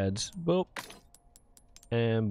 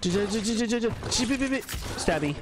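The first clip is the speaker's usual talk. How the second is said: silly stutter voice; 10 semitones higher, faster than usual. Pretend.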